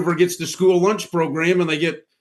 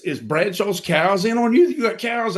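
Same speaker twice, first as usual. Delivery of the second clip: higher, slow, disbelieving; incredulous neighbor; 7 semitones higher than usual. Retold or imitated. Mimicking the skeptical neighbor, high and slow. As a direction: play the neighbor who can't believe it. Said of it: "Guy's like".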